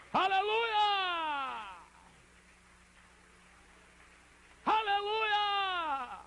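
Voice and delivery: long high hallelujah cry; exultant shout. Real, not acted. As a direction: belt it out, drawn out and falling away. Preacher exults at the altar.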